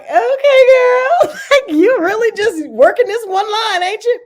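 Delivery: high, laughing tease; laughing, loud and high; teasing laugh. Retold or imitated. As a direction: cackle through the teasing. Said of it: "And they were like".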